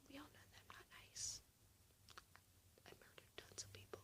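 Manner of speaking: whispered mock confession; joking whisper. Vlogger jokingly confessing to viewers in a whisper. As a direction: whisper it like a dark secret. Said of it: "I'm just kidding".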